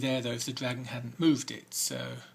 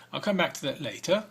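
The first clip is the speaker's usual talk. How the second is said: usual speaking voice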